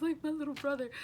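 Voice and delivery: ordinary talk, nothing marked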